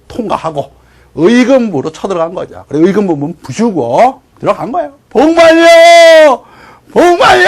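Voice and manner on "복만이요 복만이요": long drawn-out high shout of a name; calling out. Acted. Brother storming the jail for prisoner, calling out. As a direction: holler as if searching through a prison.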